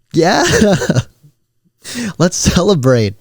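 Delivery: high-pitched, laughing mock celebration; acted giddy agreement; laughing cheer. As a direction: cheer along through giggles. Playing along with relieved friend.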